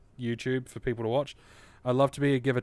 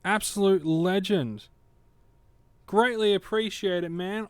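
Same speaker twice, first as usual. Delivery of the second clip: excited; 7 semitones higher than usual. Real, not acted. Thanking a gifted sub excitedly.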